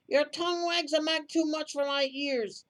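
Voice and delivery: loud, threatening coach driver. Acted. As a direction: raise the pitch and snap a loud threat. Driver warns the quarrelling man.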